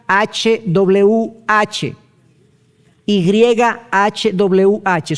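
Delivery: "Y H W H" spelled letter by letter, very slowly; name each letter of "Y H W H" with long gaps; spelling out letters. Shown as a demonstration. Preacher spelling the divine name for the congregation, very slow letters. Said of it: "eso es Y".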